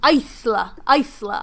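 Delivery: Isla with long i and hissed s; sibilant S. Shown as a demonstration. Trying out the book's description, hissing the s. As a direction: say Isla as ICE-luh. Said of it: "Saying the S like a snake's hiss".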